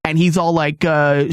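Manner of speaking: ordinary talk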